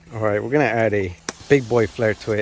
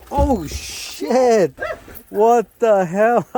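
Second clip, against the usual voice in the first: burned and startled; 9 semitones higher, much slower than usual. Real, not acted. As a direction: react to a sudden burn. Ow, my back is burning.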